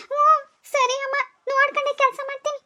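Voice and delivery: put-on high, meek girl's voice; high meek reply; meek young woman. Vasu answers mother meekly.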